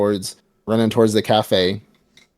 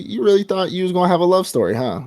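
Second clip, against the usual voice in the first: higher, faster sneering taunt; mocking taunt; 8 semitones higher than usual. Makima taunting Reze, high and mocking. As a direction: rub it in, mock her.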